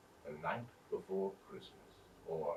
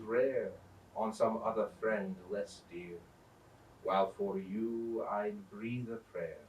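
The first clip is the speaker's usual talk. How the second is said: poem recital; 8 dB louder than usual. A narrator's reading. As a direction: savour each line like a heartfelt blessing.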